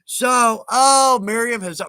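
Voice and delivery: excited call. Real, not acted. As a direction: call out with sudden delight. Miriam arrives, calls out.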